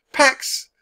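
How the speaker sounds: put-on very high child's cry; girl's shout. A narrator's reading. Annie to the horsemen, shouting for peace. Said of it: "Annie shouted".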